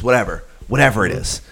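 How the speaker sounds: ordinary talk, nothing marked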